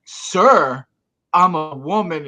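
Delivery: loud, high offended protest; indignant retort. Retold or imitated. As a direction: snap back, offended and loud. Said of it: "And she goes".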